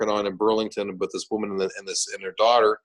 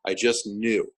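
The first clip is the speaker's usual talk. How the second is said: normal talking voice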